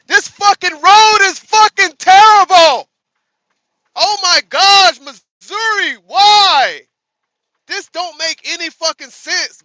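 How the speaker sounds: loud, high, exasperated yelling at the road; furious rant. Real, not acted. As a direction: shout your frustration at every bump. Ranting about terrible bumpy road.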